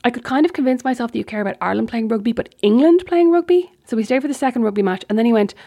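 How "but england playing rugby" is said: pitched way up and louder in disbelief; can you believe it, England; louder, higher exasperated question; incredulous. Real, not acted. Exasperated disbelief about rugby.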